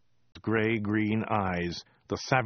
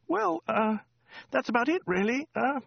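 high, hesitant falsetto; squeaky woman. A narrator's reading. Woman running out of news, halting high squeak. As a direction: falter along in a squeaky voice.

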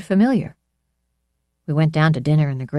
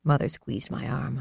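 usual speaking voice

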